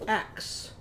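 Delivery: "Axe" whispered softly; whispered word. Shown as a demonstration. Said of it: "Can you guess my word".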